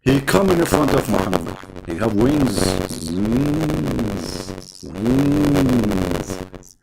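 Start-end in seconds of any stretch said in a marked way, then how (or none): none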